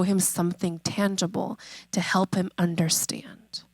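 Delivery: dropped to a whisper. Real, not acted. Preacher hushes to stress God's answer, whispered. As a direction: lean in and whisper it.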